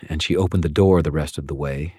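ordinary delivery, nothing marked

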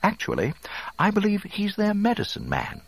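very high, fussy droid voice; officious robot, high and precise; Three P O. A narrator's reading. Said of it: "three P O corrected".

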